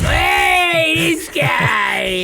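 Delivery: high, loud cheek-pinching New Yorker; New York wise guy. Pretend. Teasing cohost about a New York uncle, loud wise guy shout. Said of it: "does he pinch your cheeks".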